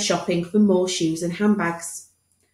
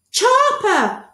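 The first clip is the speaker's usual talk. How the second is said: very high, loud eager shout; eager boy; 13 semitones higher, 6 dB louder than usual. Acted. Boy recalling surname, eager shout. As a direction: blurt out the name.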